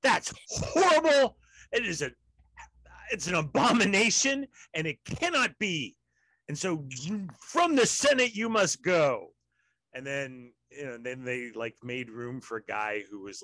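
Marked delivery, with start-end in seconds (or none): 0.0-9.3 loud, high, hammed-up indignation; mock outrage. Mocking those who ousted Al Franken from the Senate, hammed-up outrage. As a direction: pronounce a theatrical banishment, pitch leaping on key words.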